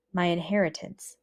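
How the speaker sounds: usual speaking voice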